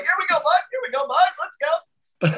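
very high-pitched pep-talk yelp; mock locker-room hype in a sky-high voice; hyped-up rallying. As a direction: squeal it out to pump up the boys. Acting out rallying teammates in the room.

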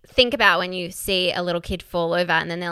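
ordinary delivery

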